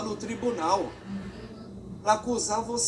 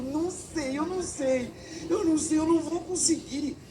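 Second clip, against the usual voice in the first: put-on anxious child; 6 semitones higher than usual.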